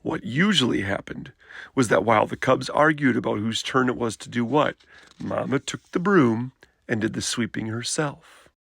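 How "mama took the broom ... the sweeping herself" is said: ordinary delivery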